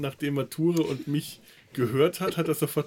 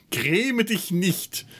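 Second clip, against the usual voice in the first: mock theatrical; 7 semitones higher, much slower than usual. Playful archaic exclamation.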